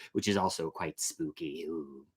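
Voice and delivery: plain reading voice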